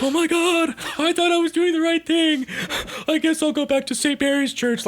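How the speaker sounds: very high-pitched, hammed-up lament; mock distraught nun. Nun learning the girl killed herself, mock despair. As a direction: wail it in mock guilt.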